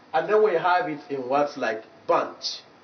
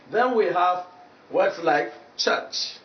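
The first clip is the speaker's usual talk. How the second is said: normal talking voice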